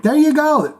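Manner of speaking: a high, loud cheer of approval; excited cheer. Retold or imitated. On the treadmill, loving every song, a high excited cheer. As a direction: cheer each song on like a happy runner. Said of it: "every song kind of was like".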